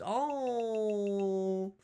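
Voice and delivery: high, soft, drawn-out "oh"; long dismayed wail. Acted. Player reacting to terrible news, high drawn-out moan. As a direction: wail it slowly, sliding down.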